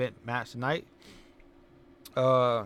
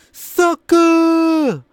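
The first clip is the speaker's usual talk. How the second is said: very loud, high, drawn-out holler; catchphrase shout; 14 dB louder than usual. Pretend. Recapper mimicking the champ's catchphrase, a big mock shout. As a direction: belt out SUCKER and hold it.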